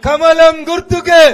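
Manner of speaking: slow, very loud rally chant; loud slogan. Real, not acted. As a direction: belt out the slogan with everything. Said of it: "చెల్లెళ్ళు అంటలేరు".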